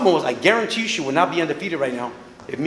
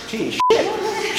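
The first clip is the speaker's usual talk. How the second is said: scornful mock verdict; 10 semitones higher, much slower than usual. Trainer mocks overrated fighter.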